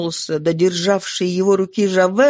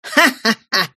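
a cold, scornful ha-ha-ha; mocking laugh. A narrator's reading. Javert laughing scornfully at the arrested mayor. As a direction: laugh in triumph at his prisoner. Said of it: "Жавер разразился смехом".

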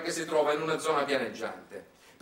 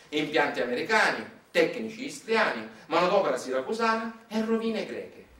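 ordinary talk, nothing marked

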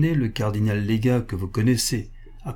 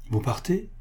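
« Vous partez ? » vif, plus aigu, dépité; lâcher la question vivement, avec regret; quick dismayed question; 6 semitones higher, 4 dB quieter than usual. A narrator's reading. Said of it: "dit vivement le prince". (le prince, dépité, retient Madeleine)

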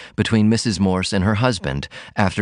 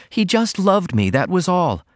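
put-on higher girlish voice, breathless confession; Ruth confessing; 10 semitones higher than usual. A narrator's reading. Daughter confessing a first kiss, high girlish voice. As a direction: let the memory spill out. Ruth's voice held.

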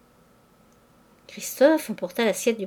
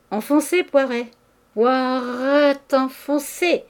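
raised, gleeful jeer; 4 semitones higher, 4 dB louder, slower than usual. A narrator's reading. Young diners mocking Poiret's blunder, jeering. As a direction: taunt him, stretching out the last repeat.

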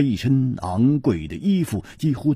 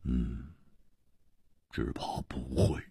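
soft, near-whispered resignation; gloomy whisper; 8 semitones lower, 13 dB quieter than usual. Acted. 易点红黯然回答同伴, soft whispered gloom. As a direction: let it die to a whisper of regret. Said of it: "黯然说".

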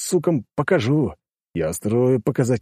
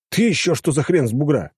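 surprised; 6 semitones higher than usual. A narrator's reading. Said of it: "удивился я".

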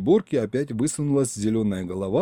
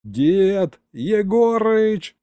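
higher, louder drawn-out shout; the grandson yelling for his grandfather; 9 semitones higher, 5 dB louder, much slower than usual. A narrator's reading. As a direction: bellow grandpa's name across the room. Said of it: "снова завопил я".

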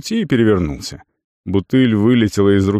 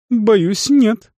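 apologetic; 12 semitones higher than usual. A narrator's reading. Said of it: "извиняющимся тоном ответил".

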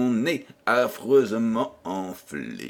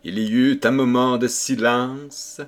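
plain reading voice

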